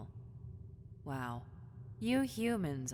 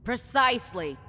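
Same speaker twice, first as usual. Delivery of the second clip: loud triumphant; 5 semitones higher, 12 dB louder than usual. Acted.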